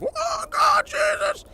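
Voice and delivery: very high-pitched imitation of panicked pepper eaters; mock freak-out. Imitating guys eating hot pepper slivers, mock freak-out. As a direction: squeal it in exaggerated panic. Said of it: "they're just freaking out".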